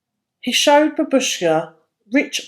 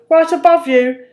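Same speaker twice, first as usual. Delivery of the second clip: higher, louder, amused reply; jolly shepherd; 6 semitones higher, 5 dB louder than usual. A narrator's reading. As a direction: tease her kindly. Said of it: "he chuckled".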